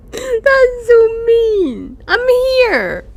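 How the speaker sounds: high, drawn-out hurt wail; tearful high-pitched complaint; whiny mock crying. Real, not acted. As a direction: whine loudly as if the game wronged you. Whining that game denies them.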